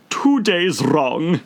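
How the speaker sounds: a mournful, slow Hatter; sighing. Acted. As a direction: sigh it out sadly. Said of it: "sighed the Hatter".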